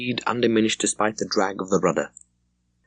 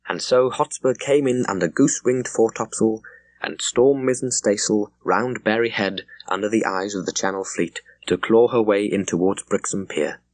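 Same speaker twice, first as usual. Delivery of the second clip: ordinary delivery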